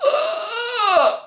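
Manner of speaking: high-pitched drawn-out mock wail; mock horrified cry. Husband seeing his wife's dead body, mock horrified cry. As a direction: cry out in exaggerated shock.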